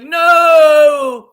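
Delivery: long, high yelled no; shouted refusal. Acted. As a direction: bellow it to stop them.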